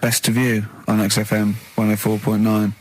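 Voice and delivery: low, steady DJ read; radio back-sell. Acted. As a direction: announce the track like a DJ.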